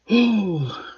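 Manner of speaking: high, long voiceless-sounding sigh. Real, not acted. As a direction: let out a long, falling sigh. Sighs before signing off.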